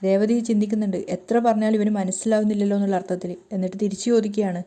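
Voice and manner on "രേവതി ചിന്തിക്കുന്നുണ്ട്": ordinary delivery, nothing marked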